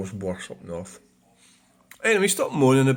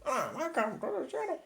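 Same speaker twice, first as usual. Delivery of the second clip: high, much softer mock welcome; soft falsetto greeting; 10 dB quieter than usual. Repeated greeting, softer take.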